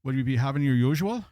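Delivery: hammed-up, lilting Irish bartender; horrible Irish accent. Bartender asks an Irish lass her order, bad Irish accent. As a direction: put on a bad Irish brogue. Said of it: "My horrible Irish accent".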